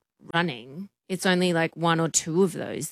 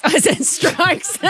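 higher, laughing through the words; 8 semitones higher, 4 dB louder, slower than usual. Real, not acted. Co-host owning up to saying strikes for swipes, laughing. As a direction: crack up at your own slip. Laughing at own slip of tongue.

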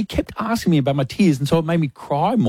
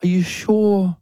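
higher mock-worried woman asking again; worried lady; 5 semitones higher than usual. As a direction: press her gently with concern. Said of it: "And she goes".

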